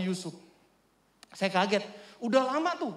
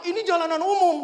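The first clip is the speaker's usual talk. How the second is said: raised, higher-pitched indignant voice; retold angry retort; 8 semitones higher, 6 dB louder than usual. Preacher quoting the angry neighbour blocking his gate, angry retort. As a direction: imitate a man getting angrier. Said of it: "dia lebih marah".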